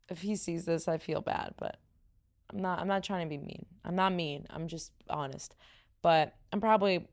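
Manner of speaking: ordinary talk, nothing marked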